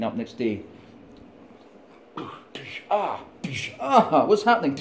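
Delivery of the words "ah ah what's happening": mock panic. Imagined slave waking in chains.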